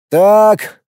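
doomed exasperation; resigned. A narrator's reading. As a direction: give up on him with one word. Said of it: "обречённо хлопнув себя по лбу".

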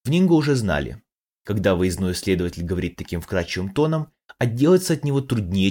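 ordinary delivery

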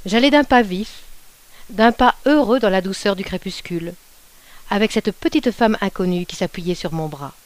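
ordinary delivery

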